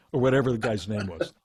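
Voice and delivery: ordinary delivery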